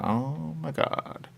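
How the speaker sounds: high, soft, breathy mock gasp; a mock stunned half-whisper; a light hint of disbelief; hushed oh my god. Voicing people's reaction to changes.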